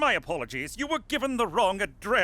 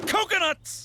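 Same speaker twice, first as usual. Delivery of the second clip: higher, punchier coconuts; an exclaimed Coconuts! with a high falling tune; excited exclaim; 8 semitones higher than usual. Shown as a demonstration. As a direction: burst out with Coconuts! for effect. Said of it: "They have coconuts".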